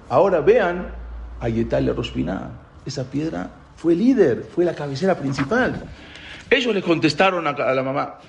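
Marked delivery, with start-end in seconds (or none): none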